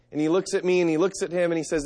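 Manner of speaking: ordinary delivery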